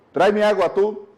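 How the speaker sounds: a husband barking the order high and loud; harsh command. Retold or imitated. Husband rudely ordering his wife to bring water, harsh loud command. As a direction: throw the order at her like a blow. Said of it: "te vas a sentir agredida".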